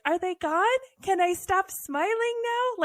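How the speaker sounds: a smiling doll cracking at last; tour guide Barbie. Retold or imitated. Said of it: "tour guide Barbie at the very end is like".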